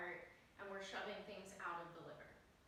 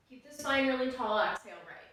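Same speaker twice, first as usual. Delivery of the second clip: called-out cue; 2 semitones higher, 14 dB louder than usual. Real, not acted. Calling cue during twisting breath.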